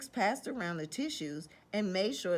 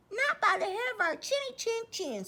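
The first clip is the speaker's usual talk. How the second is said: high, squeaky refusal; squealing little pig. Acted. Pig refusing the wolf through the door, squealing high. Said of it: "squealed the little three pigs".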